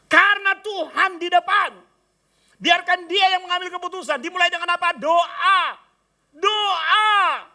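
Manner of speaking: high and loud, pounding each phrase; rising sermon cry on prayer; full-force preaching. Acted. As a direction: preach it out to the congregation. Preacher building to a climax.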